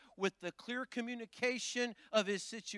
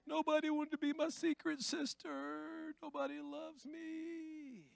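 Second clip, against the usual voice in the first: mope like nobody loves you, in a higher whine; mock whining self-pity; 5 semitones higher, 5 dB quieter than usual. Mocking a self-pitying churchgoer, whiny and higher. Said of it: "it don't mean".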